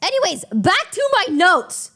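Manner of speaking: cheerful host reset; perkier retake. Shown as a demonstration. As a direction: say it again, upbeat and on cue. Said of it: "I'll show you".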